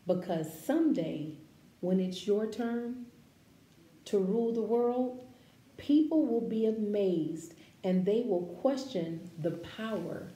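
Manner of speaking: measured, expressive read-aloud voice; gentle picture-book narration; reading to children. Acted. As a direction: read it like a storybook for a class.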